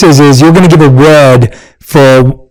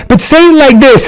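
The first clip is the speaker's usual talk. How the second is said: higher-pitched take on a wife's coaching; lightly mimicked wife, high voice; imitating wife; 9 semitones higher than usual. Acting out wife's feedback.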